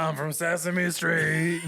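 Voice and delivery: loud, slow husky monster rasp; Cookie Monster. Pretend. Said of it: "Sound like Cookie Monster".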